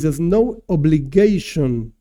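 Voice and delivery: obligation drawn out and emphatic; stress on obligation. Shown as a demonstration. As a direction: lean hard and slowly on "obligation". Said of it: "I stress the word obligation".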